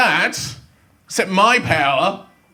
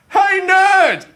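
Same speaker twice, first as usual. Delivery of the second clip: high taunting holler; mock bully call; 11 semitones higher, 3 dB louder than usual. Imagined passer-by hailing a nerd, mock bully call.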